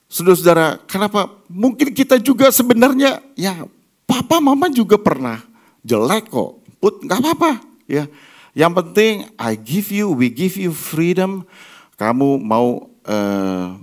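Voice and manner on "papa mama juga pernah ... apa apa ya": ordinary delivery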